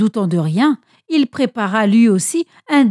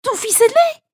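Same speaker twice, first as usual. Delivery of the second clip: protestation pressante, voix très aiguë; insiste, la voix haut perchée; insistance; 13 semitones higher, 4 dB louder than usual. A narrator's reading. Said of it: "insista-t-il". (fils qui supplie qu'on le reconnaisse, très aigu)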